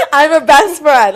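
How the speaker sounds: loud, high re-enactment with laughter; mock defiant declaration. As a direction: blurt it out defiantly, half laughing. Re-enacting her own standoff.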